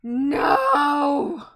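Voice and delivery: long, loud wail of NO; dismayed shout. Real, not acted. Player hears the hated prosecutor's name, dismayed shouting. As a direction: cry it out in loud, drawn-out protest.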